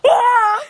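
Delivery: short, loud high scream; fangirl scream. Real, not acted. Reader reacting to a swoony line, loud scream.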